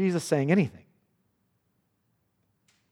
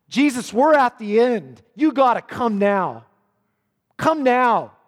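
loud, desperate begging, higher; urgent plea; 5 semitones higher, 5 dB louder than usual. Acted. Jairus urging Jesus to hurry to his daughter, loud and desperate. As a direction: beg him to come right now. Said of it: "It's, it's intense".

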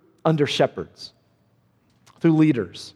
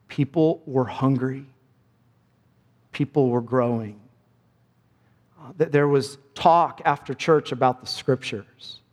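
ordinary talk

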